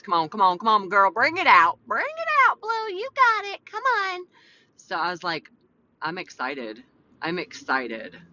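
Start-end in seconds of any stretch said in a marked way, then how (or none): 0.0-4.3 hammed-up pep talk climbing to high squeal; coaxing out her real voice. Host urging herself to find her real voice, squeaky pep talk. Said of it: "Where's my real voice?". 6.0-8.2 low, unexcited-sounding statement; deadpan real voice. Shown as a demonstration. Host showing husband her real voice, low deadpan. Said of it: "Like, that's my real voice".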